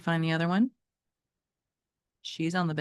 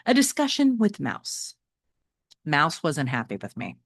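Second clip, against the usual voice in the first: reading a novel aloud to the class; teacher reading a chapter book to students; story narration; 2 semitones higher, 5 dB louder than usual. Acted. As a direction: tell it like a read-aloud storyteller.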